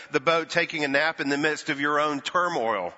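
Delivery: ordinary talk, nothing marked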